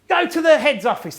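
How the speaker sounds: loud, high teacher's order; mock irate command; losing the plot. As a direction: send a pupil out, imitating an angry teacher. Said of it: "just lost the plot".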